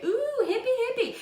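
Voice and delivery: mock hippie. Mocking her own artist phase.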